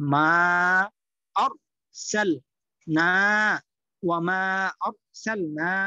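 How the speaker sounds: মা, অর, সেল, না said one part at a time, with pauses; teacher modelling broken reading, with a gap after each part. Shown as a demonstration. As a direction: spell out each piece of ওয়া মা slowly. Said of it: "উচ্চারণগুলা দেখে দেখে পড়বেন".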